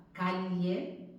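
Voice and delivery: "calle" with a palatal L, like "cal-yeh"; "calle" as KA-lyeh; Castilian LL in calle. Shown as a demonstration. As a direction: say "calle" keeping a true LL sound, tongue on the palate. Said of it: "no nos resulta tan extraña".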